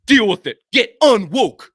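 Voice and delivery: mocking read of a fan's angry post; mock fan rant. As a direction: ham up the outraged commenter. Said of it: "one of these stupid internet posts".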